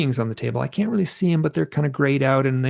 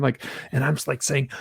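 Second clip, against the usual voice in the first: ordinary talk, nothing marked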